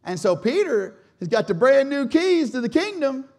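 usual speaking voice

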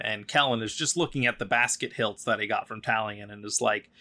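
ordinary talk, nothing marked

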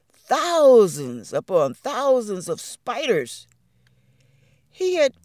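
usual speaking voice

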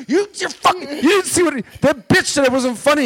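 high, loud outraged protest; retold angry protest. Comic protesting to the club owner, angry. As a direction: vent at the boss.

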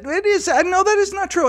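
high-pitched retold objection; high-pitched protest. Retelling a Twitter argument, high-pitched protest. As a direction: jump up high for the quoted protest.

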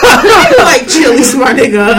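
loud, high, drawn-out heckle; high-pitched playful hollering; mock outrage. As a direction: call out your friend in loud mock disbelief. Mock outrage at absent friend.

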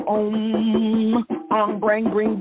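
normal talking voice

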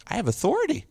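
high-pitched mock marvelling; retold wonder, voice jumping up; amazed discovery. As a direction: marvel at your own new power. Acting out his amazement at being obeyed.

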